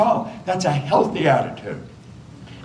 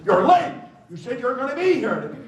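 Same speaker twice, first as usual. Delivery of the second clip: mock scolding wife; 9 semitones higher, faster than usual. Wife scolding a husband who came home late, mock annoyed. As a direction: play the annoyed wife, snapping at him.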